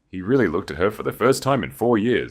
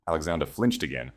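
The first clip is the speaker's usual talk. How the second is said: plain reading voice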